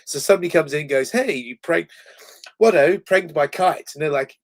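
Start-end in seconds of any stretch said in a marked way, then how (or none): 1.1-3.9 posh street speak. Retold or imitated. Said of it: "in a posh accent".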